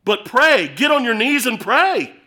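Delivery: a fiery altar call; rousing call. Real, not acted. Climactic call to prayer.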